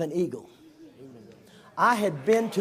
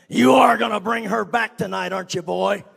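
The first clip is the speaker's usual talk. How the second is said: real rough voice; 2 semitones higher, 8 dB louder than usual. Retold or imitated. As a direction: lean on him like a threat. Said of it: "in a real rough voice".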